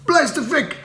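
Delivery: high cheering voice; mock crowd cheer; crowd shout. A narrator's reading. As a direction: shout it like a happy villager. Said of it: "received with shouts of".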